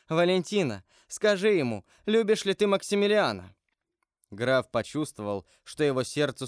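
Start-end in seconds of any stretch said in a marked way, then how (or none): 0.1-3.5 high, urgent heroine's plea; high-pitched, emotional urging; impassioned appeal. Acted. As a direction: appeal to a friend with rising fervour. Haydée appeals passionately to Valentina.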